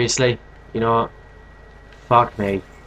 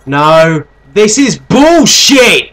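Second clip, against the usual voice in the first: loud drawn-out furious shout; raging gamer; 5 semitones higher, 6 dB louder, slower than usual. Real, not acted. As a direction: howl with outrage, slower. Raging at death.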